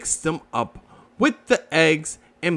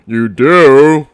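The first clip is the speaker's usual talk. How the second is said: surprised Eeyore; 2 semitones lower, 13 dB louder than usual. Acted. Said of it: "surprised that anyone would want".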